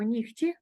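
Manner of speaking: нігті said with a voiceless kh, nikhti; devoiced h pronunciation. Shown as a demonstration. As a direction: say нігті as heard, kh not h. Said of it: "ми вимовляємо".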